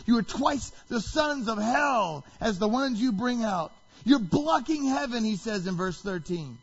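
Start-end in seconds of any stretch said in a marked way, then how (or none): 0.0-5.2 raised, high-pitched denunciation; really loud. Retold or imitated. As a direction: accuse forcefully, voice lifted. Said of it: "then it gets really loud".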